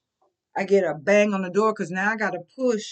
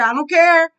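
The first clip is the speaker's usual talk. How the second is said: loud, higher I don't care; defiant shout; 6 semitones higher, 9 dB louder than usual. Real, not acted. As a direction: throw it out angrily. Heated defiance.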